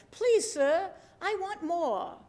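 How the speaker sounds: high, hammed-up English accent, begging; horrible English accent. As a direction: plead like a hungry English boy, accent badly faked. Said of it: "I have a horrible English accent".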